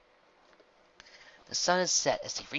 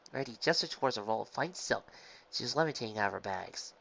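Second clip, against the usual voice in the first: plain reading voice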